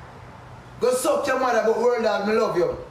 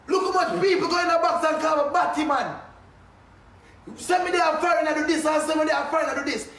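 angry rant; 7 semitones higher than usual. Real, not acted. Venting at a friend over a betrayal, building to furious shouting. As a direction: lash out at a friend who betrayed you, rising to yelling.

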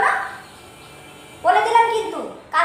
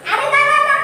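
insistent protest; 9 semitones higher, 5 dB louder than usual. Acted. Mother insists on oiling hair.

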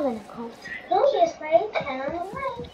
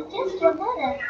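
ordinary talk

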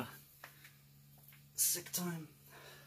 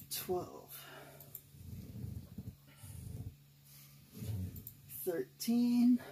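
tired, breathy rep counting; doing push ups; 12 dB quieter, much slower than usual. Real, not acted. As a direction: count reps through heavy breathing. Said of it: "My body went to old man mode".

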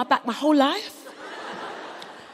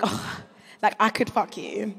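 sultry aside; 6 semitones lower, 7 dB quieter, slower than usual. Retold or imitated. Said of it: "you're like".

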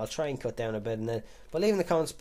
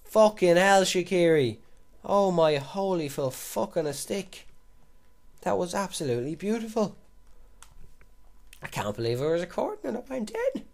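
thrilled; 7 semitones higher, 5 dB louder than usual. Real, not acted. Still thrilled after the goal.

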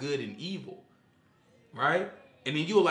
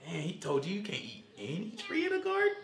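sly serpent; 5 semitones higher, 5 dB quieter than usual. Retold or imitated. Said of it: "but I'm".

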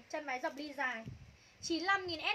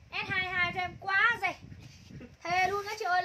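loud excited pitch; 4 semitones higher, 6 dB louder than usual. Acted. Hyping the deal to viewers.

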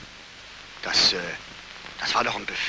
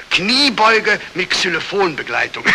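laughing; 5 semitones higher, 6 dB louder, slower than usual. Acted. Colonel mocks, laughing.

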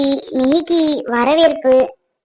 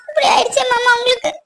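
high, tearful lament; crying; 8 semitones higher than usual. Acted. Wife grieving over husband's injury, crying. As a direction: sob the words out.

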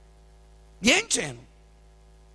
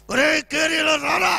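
loud, slow call across the house; 8 semitones higher, 7 dB louder, slower than usual. Retold or imitated. Father calling his son Carey over, loud and slow. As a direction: summon the boy sternly.

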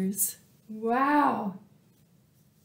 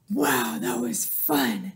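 low dog voice; 12 semitones lower than usual. Acted. Panting Dog speaks.

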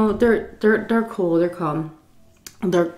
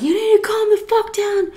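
high strained yell at her; retold screaming; 14 semitones higher, faster than usual. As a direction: shout it up high, outraged. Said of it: "And he was just screaming".

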